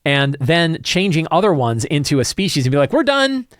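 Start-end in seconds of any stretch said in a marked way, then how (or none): 2.9-3.5 a sarcastic, high mock quote; mock designer voice. Mocking the designers who dropped the orc stat block, sarcastic. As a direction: sneer it like a smug designer.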